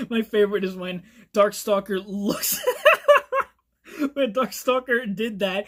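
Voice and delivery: breaking into laughter mid-sentence; real amusement bubbling through the words; laughing. Real, not acted. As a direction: try to tell it while cracking up. Cracks up while telling favorite part.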